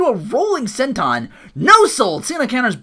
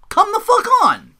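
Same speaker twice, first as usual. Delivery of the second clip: much higher, louder exasperated outburst; 8 dB louder than usual. Retold or imitated. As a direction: throw your hands up at the screen. Fans frustrated with cue cards.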